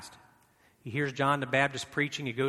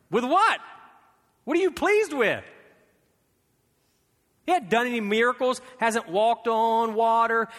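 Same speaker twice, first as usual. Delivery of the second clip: much higher and louder, pressing the question; incredulous preaching; 9 semitones higher, 6 dB louder than usual. Real, not acted. Preacher asking God what pleased him, loudly. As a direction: demand an answer, disbelieving.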